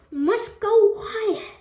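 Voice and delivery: high, loud exclamation; excited urging. A narrator's reading. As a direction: urge them on loudly. Wonka urging the elevator higher.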